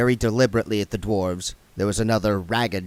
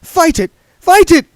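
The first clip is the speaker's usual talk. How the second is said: high, loud cheering shout; 12 dB louder than usual. Acted. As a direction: urge him on. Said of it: "shouted Sacharissa".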